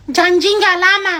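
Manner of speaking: put-on child voice.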